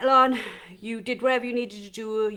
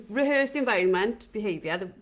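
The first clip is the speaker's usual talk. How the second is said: ordinary talk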